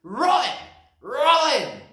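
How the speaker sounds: high, very loud shout; high loud warning cry; loud yell. Acted. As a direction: yell it running past. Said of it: "they yelled".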